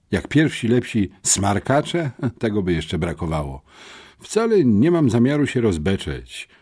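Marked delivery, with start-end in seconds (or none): 4.2-6.5 bravely. A narrator's reading. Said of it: "odparł mężnie Syfon".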